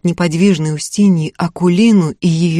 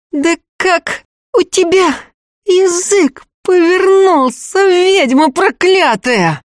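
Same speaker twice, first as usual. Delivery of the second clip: higher, slow hoarse hiss through clenched teeth; 11 semitones higher, slower than usual. A narrator's reading. Akulina at Ustinya, hoarse hatred through teeth. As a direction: spit it through gritted teeth. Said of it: "Хрипло сквозь зубы выговорила Акулина".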